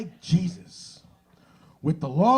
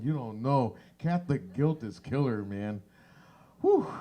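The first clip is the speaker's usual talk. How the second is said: normal talking voice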